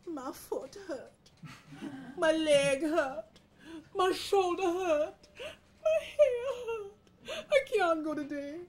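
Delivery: high, slow, whimpering complaints; hammed-up whiny invalid; malingering cockroach. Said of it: "the cockroach is still working his thing".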